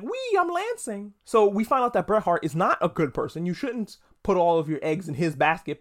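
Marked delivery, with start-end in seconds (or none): 0.0-1.1 high, slow sing-song squeal of fun; giddy kid glee. Retold or imitated. Fan imitating himself enjoying the lance camera, giddy and high. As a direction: play a kid on a carnival ride. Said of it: "I was like".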